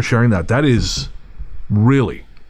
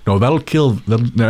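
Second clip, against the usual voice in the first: usual speaking voice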